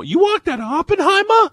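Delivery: loud, shrieking-high cartoony Massachusetts accent. Host reading out the bit for listeners, in a cartoony Massachusetts accent. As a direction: yell it incredulous in broad Massachusetts. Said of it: "it's a Massachusetts accent".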